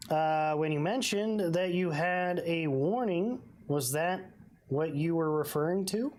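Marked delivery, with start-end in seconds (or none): none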